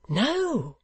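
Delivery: high-pitched, eager outburst; eager, high character voice; eager cry. A narrator's reading. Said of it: "cried Barnaby eagerly".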